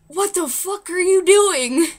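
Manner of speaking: high, loud, drawn-out incredulous question; retold disbelief. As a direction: act out being thoroughly weirded out, stretching each word. Retold stunned reaction to creepy stranger.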